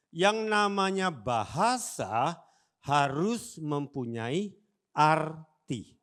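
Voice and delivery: slow, weighty words, ending loud on arti; emphatic slow teaching. Shown as a demonstration. Pastor stressing the lesson slowly to the congregation. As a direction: spell it out slowly for the church. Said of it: "emphatic teaching".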